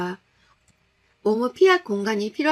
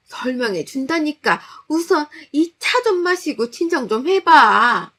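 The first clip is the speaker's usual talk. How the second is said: insistent mother-in-law; 6 semitones higher, 7 dB louder than usual. Acted. Mother-in-law offering drugged tea.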